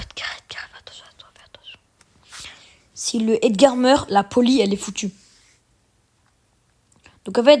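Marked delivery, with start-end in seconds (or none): 0.0-1.8 whispered warning, very soft; whispering. Real, not acted. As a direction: warn them under your breath.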